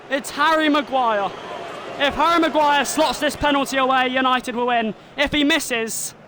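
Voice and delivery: excited play-by-play, rising with each penalty; live commentary. Acted. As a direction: call the shootout like a TV commentator.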